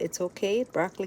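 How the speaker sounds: ordinary talk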